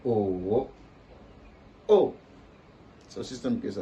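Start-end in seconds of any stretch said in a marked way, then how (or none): 0.0-0.7 O dipping, then rising; third tone. Shown as a demonstration. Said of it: "On applique la même chose". 1.9-2.1 O short and falling sharply; fourth tone. Shown as a demonstration. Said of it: "On applique la même chose".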